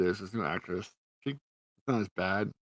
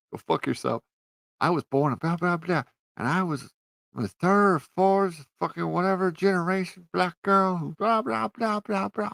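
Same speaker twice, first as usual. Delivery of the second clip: loud, high mock speech building in pitch; mocking rant; 9 semitones higher, 8 dB louder, slower than usual. Reviewer mocking the heroine's speech about her suit, mocking rant. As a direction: rattle off a ridiculing impersonation, rising higher.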